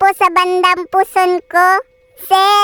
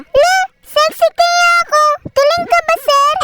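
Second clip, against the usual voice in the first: playful indignation; 10 semitones higher than usual. Acted. Employee rebuffing the boss's remark on weight, high and cheeky. Employee retorts to teasing boss.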